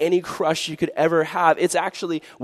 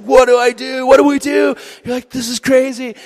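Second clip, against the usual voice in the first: high, loud flustered panic; frantic and overwhelmed; mock panic; 12 semitones higher, 8 dB louder than usual. Teen panics about telling parents, high and loud.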